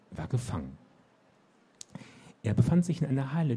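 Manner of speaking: put-on lively read-aloud narration for young readers; story narration. A narrator's reading. Author reading her children's book to listeners. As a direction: tell the story with animated expression.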